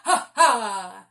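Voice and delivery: loud booming ha ha. Shown as a demonstration. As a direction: laugh out big from the belly. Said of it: "now we're going to do ha".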